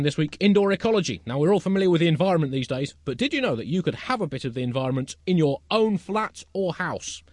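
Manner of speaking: mock presenter. Presenter pitching indoor ecology to listeners, mock promotional delivery. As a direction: sell the tips to the audience.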